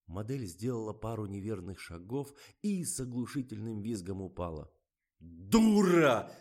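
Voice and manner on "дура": high, very loud insult; angry yell. A narrator's reading. Director yells at the fallen model, furious. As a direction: bellow it in fury. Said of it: "заорал Николай".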